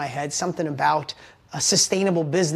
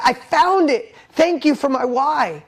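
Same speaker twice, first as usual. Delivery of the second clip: elated relief; 6 semitones higher, 4 dB louder, slower than usual. Retold or imitated. Breakthrough moment retold.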